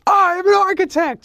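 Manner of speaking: squeaky slowed parody of the actor; mock Keanu deadpan. Director mocking Keanu's line, high and slow. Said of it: "He's like".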